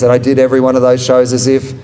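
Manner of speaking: normal talking voice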